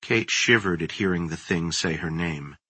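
ordinary delivery

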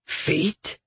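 understated, hushed growl. A narrator's reading. Soldier insulted by voice in his mind, growled. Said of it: "he growled".

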